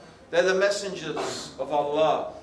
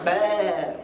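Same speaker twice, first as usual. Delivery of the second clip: drawn-out mock sheep's baa; sheep bleat.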